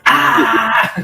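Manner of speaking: victory cry. Acted. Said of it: "just like".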